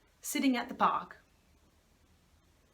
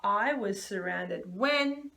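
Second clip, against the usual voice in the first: slow sentence starter; 4 dB louder, much slower than usual. Shown as a demonstration. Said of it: "Now I want you to make a sentence".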